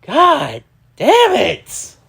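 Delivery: ordinary talk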